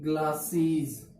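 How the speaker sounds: Glasses drawn out slowly, sound by sound; slow teaching demo. Shown as a demonstration. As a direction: say glasses slowly so the class hears each part. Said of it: "कैसे लिखते हैं?". (Glasses को धीरे-धीरे साफ़ बोलो)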